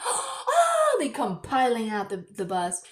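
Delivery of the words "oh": high, loud gasping oh; kids' gasp. Retold or imitated. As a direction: gasp it out high like an amazed kid. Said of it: "they're like, gasps".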